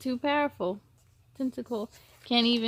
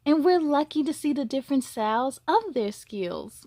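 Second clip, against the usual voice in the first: gentle storybook reading; storyteller voice for children; story narration; 4 semitones higher, 4 dB louder than usual. A narrator's reading. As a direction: read it like a bedtime story.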